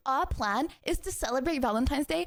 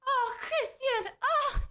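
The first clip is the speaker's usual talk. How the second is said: high, slow, gushing oh; touched squeal; 9 semitones higher, much slower than usual. Real, not acted. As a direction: melt at a sweet compliment. Touched by sweet message.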